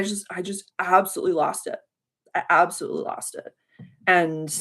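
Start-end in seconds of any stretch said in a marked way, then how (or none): none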